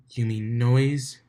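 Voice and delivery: slow, dry "You mean noise?"; dry snark. Acted. Octavia sniping about the music, slow and dry. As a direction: drag it out with disdain.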